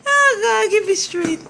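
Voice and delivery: loud mock plea. Real, not acted.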